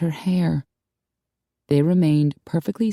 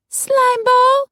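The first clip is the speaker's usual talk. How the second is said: very high, loud furious yell; 6 dB louder than usual. A narrator's reading. Tiffany screaming after her cheating lover, loud yell. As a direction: spit the insult out at full voice. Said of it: "Tiffany yelled".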